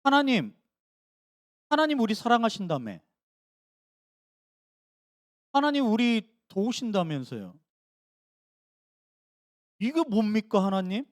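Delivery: mock complaint to God; whining complaint. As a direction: grumble to God like a hurt child. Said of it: "푸념하고 있는 거예요".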